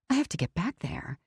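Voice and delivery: hushed panicked whisper. Acted. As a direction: breathe it out, barely voiced. Panicked narrator alone.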